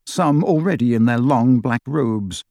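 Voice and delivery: usual speaking voice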